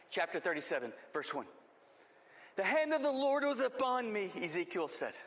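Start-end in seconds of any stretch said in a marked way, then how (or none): 2.6-4.3 scripture reading. Acted. Reads the scripture verse with emphasis.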